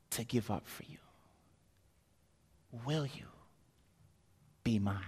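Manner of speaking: hushed, near-whispered vow of love; tender proposal. Acted. Jesus asking his beloved to be his, tender. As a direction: offer your heart very softly, with pauses.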